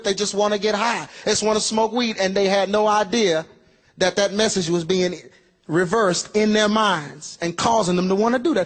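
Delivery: normal talking voice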